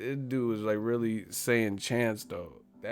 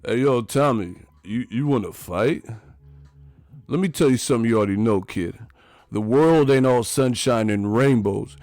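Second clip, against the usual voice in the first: Stallone impression; 2 semitones higher, 7 dB louder than usual. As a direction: growl it like Stallone. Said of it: "my Stallone on deck".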